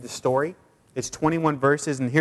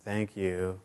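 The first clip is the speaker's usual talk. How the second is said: low, soft, grudging thank-you; rude tone; 5 semitones lower, 8 dB quieter than usual. Shown as a demonstration. Ungrateful person thanking for socks, rudely unimpressed. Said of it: "with a tone where it's, like, just rude".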